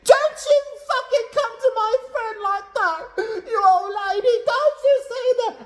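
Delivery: very high hammed-up screech; imitated angry shriek. Regina defending her friend against Kim, very high imitated shriek. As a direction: shriek it in mock outrage.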